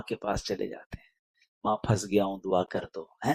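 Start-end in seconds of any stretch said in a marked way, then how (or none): 1.6-3.0 lower, hushed request to mother. Retold or imitated. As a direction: ask humbly like a troubled son. Child asking mother for prayer.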